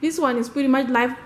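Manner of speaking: ordinary talk, nothing marked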